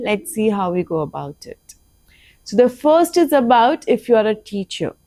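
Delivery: whispered aside. Real, not acted. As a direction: drop to a breathy whisper. Teacher suddenly whispering.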